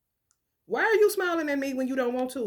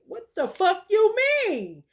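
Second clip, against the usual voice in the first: loud and high; raised heated rant; 7 semitones higher, 5 dB louder than usual. Real, not acted. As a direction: push it up, heated.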